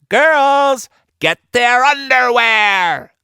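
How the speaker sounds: high, loud pirate call; calling to her crew. A narrator's reading. Stinky Ann calling her crew, high and loud. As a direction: holler the order over the battle. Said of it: "Stinky Ann called to her crew".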